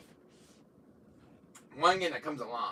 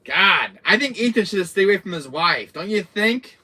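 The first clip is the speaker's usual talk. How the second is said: excited; 8 semitones higher, 12 dB louder than usual. Real, not acted. Excited appeal to viewers.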